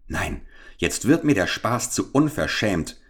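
plain reading voice

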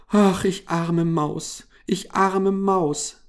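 high, slow, drawn-out lament; plaintive wail. A narrator's reading. Mouse lamenting as she is sent away, plaintive. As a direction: wail pitifully as a rejected mouse. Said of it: "klagte Mariechen Knusperkorn und schluchzte".

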